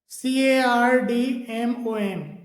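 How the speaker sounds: usual speaking voice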